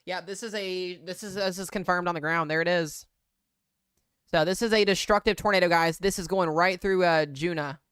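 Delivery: loud, urgent storm report; breathless shouting over wind. Real, not acted. Tornado confirmed on the ground live.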